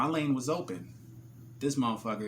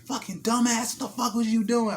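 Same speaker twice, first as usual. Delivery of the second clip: high, loud furious yell at a driver; screaming road rage at the driver who cut him off; Jersey road rage; 12 semitones higher, 5 dB louder than usual. Retold or imitated. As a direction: cuss him out, full Jersey fury. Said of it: "that's just the Jersey in me".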